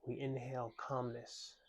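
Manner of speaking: unhurried, calming mindfulness lead; guided meditation. Real, not acted. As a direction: gently guide listeners into their breath. Leads a breathing meditation.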